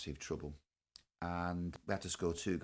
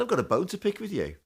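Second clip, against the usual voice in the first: mock accusing; 11 semitones higher, 6 dB louder than usual. Teasingly confronting Dennis Law.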